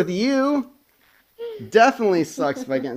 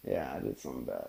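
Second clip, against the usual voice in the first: much lower and softer, rushed; low quick mutter; 20 dB quieter, faster than usual. Acted. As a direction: drop the voice down and rush it. Teasing confession of a prank.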